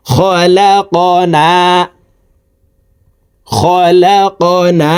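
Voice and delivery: খলাকনা chanted slowly, the আ after ল held one alif long; stretched long vowel. Shown as a demonstration. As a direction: draw out খলাকনা in a slow recitation chant. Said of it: "এক আলিফ টেনে পড়ব".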